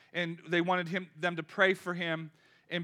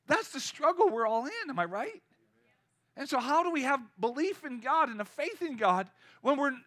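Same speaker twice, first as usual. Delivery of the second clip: ordinary delivery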